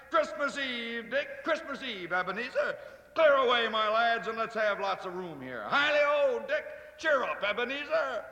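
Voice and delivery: a rich, jolly call from a big man; jovial Fezziwig. Acted. Fezziwig calls his apprentices, hearty and jovial.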